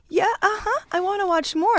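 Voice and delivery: put-on high, eager childlike insistence; eager mock whine. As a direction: plead like an excited kid. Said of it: "And I'm like".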